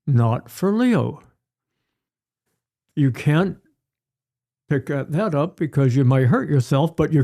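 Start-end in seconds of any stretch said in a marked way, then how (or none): 0.1-1.2 slow, deliberate "Not for Leo"; calm firm slow no. Shown as a demonstration. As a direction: spell it out slowly to a child.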